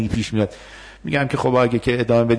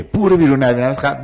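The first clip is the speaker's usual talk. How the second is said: louder, higher demo of a rude get out; aggressive dismissal; 3 semitones higher, 4 dB louder than usual. Shown as a demonstration. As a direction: snap it out as the wrong aggressive reply. (نمایش پرخاشگرانه با صدای بلند)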